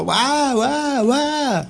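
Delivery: very high yelping barks, a dog in pain; imitated dog bark. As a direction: yelp like a small dog caught by the paw. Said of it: "Y empezó". (ladrido agudo imitando al perro)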